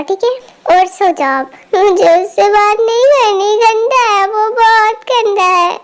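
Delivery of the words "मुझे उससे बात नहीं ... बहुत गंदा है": drawn-out sulky wail; crying child. Acted. Little girl refuses to forgive brother, tearful wailing. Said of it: "अब तुम शांत हो जाओ".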